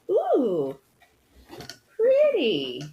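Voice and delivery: delighted ooh. Real, not acted. Admiring unboxed item.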